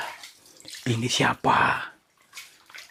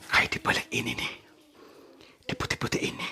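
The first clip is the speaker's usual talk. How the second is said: whisper; 9 dB quieter than usual. Real, not acted. Whispering near the hidden presence.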